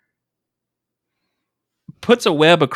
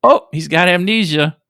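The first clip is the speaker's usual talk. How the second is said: ordinary delivery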